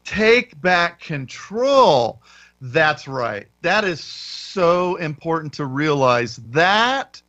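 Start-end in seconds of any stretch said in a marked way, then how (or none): 0.0-2.2 "Take back control" drawn out word by word; slow emphatic echo. Real, not acted. Host echoing co-host's key point, "Take back control" slow and emphatic.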